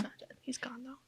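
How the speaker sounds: a hushed, breathy joke tacked on; whispered aside. Real, not acted. Podcast host whispering a spoiler-teasing aside. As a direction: drop to a whisper for the punchline. Joking aside about the character.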